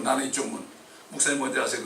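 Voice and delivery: ordinary delivery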